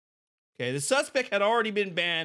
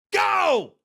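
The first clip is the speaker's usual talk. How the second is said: shouted go; 12 semitones higher, 7 dB louder than usual. Pretend. Mock director calling action.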